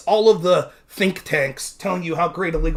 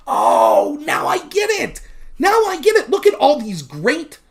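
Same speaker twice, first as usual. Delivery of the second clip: mock realization; 8 semitones higher than usual. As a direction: ham up sudden enlightenment. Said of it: "they'll be like".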